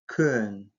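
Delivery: Көн with a fronted, slightly rounded vowel; soft lip vowel. Shown as a demonstration. Teacher showing a soft lip vowel, Көн lightly rounded and fronted. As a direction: say Көн with lips forward, tongue slightly forward. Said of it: "Мягкий".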